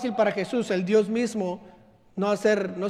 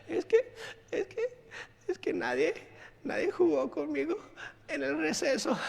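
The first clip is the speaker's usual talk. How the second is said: put-on high, softer, teary child voice; crying little girl; 7 semitones higher, 7 dB quieter than usual. Little daughter crying to her father about recess, high teary child voice. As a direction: whimper like a sad five-year-old. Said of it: "bien triste".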